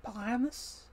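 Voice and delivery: put-on Primus with a German accent and a questioning rise; Stanley Tucci German accent. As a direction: imitate a German scientist saying Primus. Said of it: "I love the way he says that".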